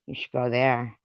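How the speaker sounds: ordinary delivery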